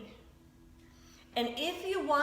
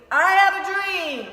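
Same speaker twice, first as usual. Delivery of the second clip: high, loud oratory on have; Martin Luther King; 7 semitones higher, 12 dB louder than usual. Retold or imitated. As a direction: proclaim it like a preacher at a rally. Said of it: "like Martin Luther King".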